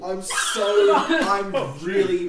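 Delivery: loud, drawn-out poor-me wail; overplayed sob story, loud and slow; hammed-up pleading. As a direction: beg pitifully at full volume. Acted plea to look sad.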